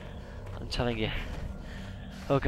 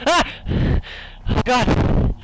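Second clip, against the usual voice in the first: terrified scream; 8 semitones higher, 6 dB louder, much slower than usual. Real, not acted. Jump scare.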